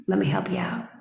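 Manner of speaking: hushed, conspiratorial offer; breathy unvoiced teasing take; mock whisper. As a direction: whisper "Let me help you out." as a sly secret. Teasing offer, whispered then slowed.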